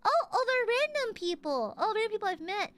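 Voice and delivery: ordinary talk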